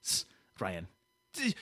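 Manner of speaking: put-on indignant French-Canadian accent; sulky French-Canadian grievance; Quebecois [name]. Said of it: "Quebecois [name] over here".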